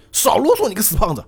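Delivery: high, heated snapping back; angry retort. Retold or imitated. Bobo snapping at the big man who lectures him, heated and high. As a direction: brush off the lecture, fired up and sharp. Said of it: "然后这个波波还火".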